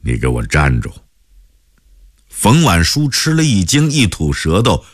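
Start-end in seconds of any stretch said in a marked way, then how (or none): 0.0-0.9 low stern order; a stern father's command. A narrator's reading. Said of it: "威严地咳嗽一声，命令荀磊".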